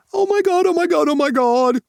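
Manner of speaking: very high, loud squeal of delight. Acted. Tyler squealing at Monty's British accent, very high and loud. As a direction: squeal like he won the jackpot. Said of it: "Tyler squeals".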